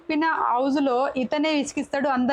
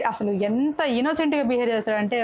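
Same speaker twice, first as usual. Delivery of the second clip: ordinary delivery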